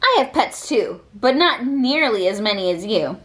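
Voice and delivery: ordinary delivery, nothing marked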